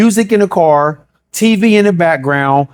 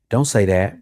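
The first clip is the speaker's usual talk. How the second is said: hushed, much softer inner voice; soft whisper of the Spirit; 8 semitones lower, 6 dB quieter than usual. Retold or imitated. The Holy Spirit gently warning the believer, hushed. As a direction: barely breathe the warning. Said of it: "He whispers".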